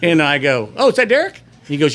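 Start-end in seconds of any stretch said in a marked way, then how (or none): 0.8-1.4 much higher, louder delighted question; bright surprise at the visitor; excited recognition. Retold or imitated. Boss hears secretary's news, asks excitedly much higher.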